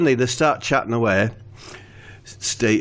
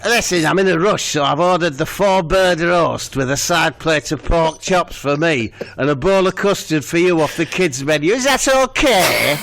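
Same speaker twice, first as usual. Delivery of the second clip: the lass ordering for Steve, higher bossy voice; voicing the big lass; 4 semitones higher than usual. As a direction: rattle off her order, pushy and high.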